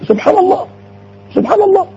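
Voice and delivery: high, hushed amazement; awed wonder. Real, not acted. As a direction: float it up in wonder. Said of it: "awed exclamation".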